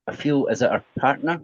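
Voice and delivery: normal talking voice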